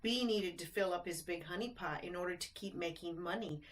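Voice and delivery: usual speaking voice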